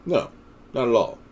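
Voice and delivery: ordinary talk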